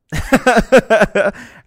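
loud, high burst of real laughter. Real, not acted. Cracking up at his parents' reaction, loud laugh. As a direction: let a big laugh explode out.

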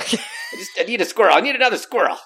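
high loud mock cry for a squirrel; desperate yell. [name] as the hungry lion in the recession, loud mock yell. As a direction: yelp like a starving lion. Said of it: "Now I'm a lion on the savannah going".

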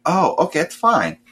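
high, breezy "oh, okay"; mock instant calm. Kid suddenly happy with the games, high breezy calm. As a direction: flip to fine in a second. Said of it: "instantly was like".

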